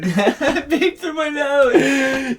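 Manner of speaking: words squeezed out through laughter; cracking up mid-sentence, slow; laughing hard. Real, not acted. Repeating the line, cracking up.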